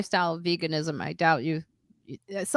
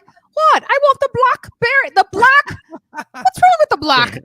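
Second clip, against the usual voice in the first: put-on much higher and louder, an innocent Irish accent; 10 dB louder than usual. Lois yelling across the table for blackberry, loud Irish accent. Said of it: "Lois has the strong Irish accent".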